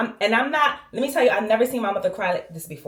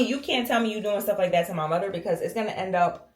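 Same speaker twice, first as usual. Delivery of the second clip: ordinary talk, nothing marked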